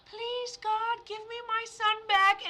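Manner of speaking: mock pleading mom. Mother begging God for her son back, hammed-up high plea. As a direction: beg God for the son like a caricature. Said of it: "You're like".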